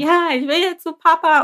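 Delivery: high mock whine of a small kid; imitated wailing child. Daughter carried off wants Papa, imitated wail. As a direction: imitate a child pleading, pitched well up. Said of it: "nach Papa gebrüllt".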